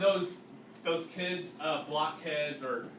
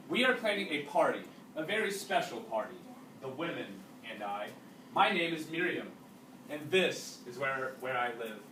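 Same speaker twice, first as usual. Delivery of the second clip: reading a story aloud to a class; unhurried read-aloud narration for students; 2 semitones lower, 4 dB quieter than usual. A narrator's reading. Teacher reading a wartime novel to the class, steady narration.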